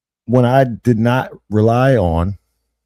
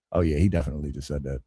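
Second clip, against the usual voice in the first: quiet quick aside; 6 semitones lower, 11 dB quieter, faster than usual. Retold or imitated. Quick mock quote, clearly conceding.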